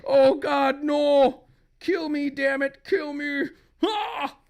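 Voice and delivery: high, loud acted outcry; agonized husband. Husband begs wife to kill him, high agony.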